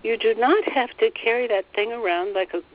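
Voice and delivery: usual speaking voice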